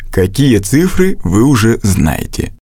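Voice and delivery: ordinary talk, nothing marked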